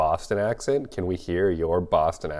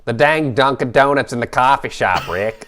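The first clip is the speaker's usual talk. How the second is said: loud, higher, badly faked Boston accent; attempted Boston accent; 4 semitones higher, 5 dB louder than usual. Shown as a demonstration. Co-host trying a Boston accent on request, hammed-up and off-target. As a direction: belt out a botched Boston accent that lands nearer the Bronx. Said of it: "try my Boston accent".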